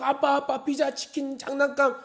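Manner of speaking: put-on very high child-like pestering; demanding child. Child claims the promised treats from dad, pestering. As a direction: reel off the demands in a high kid's voice. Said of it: "그 아이는 계속 주장하는 거예요".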